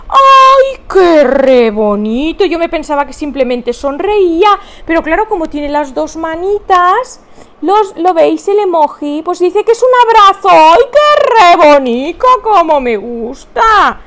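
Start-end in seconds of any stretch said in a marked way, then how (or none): 0.1-2.4 squealing "ay, qué re bonito"; delighted cry. Real, not acted. Delighted at a hug emoji. 10.5-14.0 loud, slow "qué re bonito"; drawn-out loving gush; gushing delight. Real, not acted. Repeating her delight, gushing.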